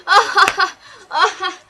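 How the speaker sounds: loud, high mock cackling; hammed-up laughing fit, high and loud; imitated hysterical laugh. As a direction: burst out laughing like the friend. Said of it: "He's just laughing hysterically".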